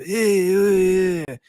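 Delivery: badly faked Italian-American mobster voice; Colin Farrell's fake mobster. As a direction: grunt it like a hammed-up movie mobster. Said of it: "Colin Farrell as an, as an Irish guy doing".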